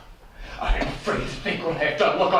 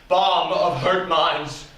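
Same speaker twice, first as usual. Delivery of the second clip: ordinary talk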